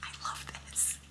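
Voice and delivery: soft awed whisper. Real, not acted. Reader whispers to viewers in awe of the scene. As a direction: barely breathe it out.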